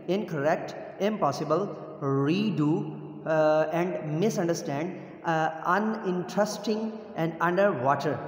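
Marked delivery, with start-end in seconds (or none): none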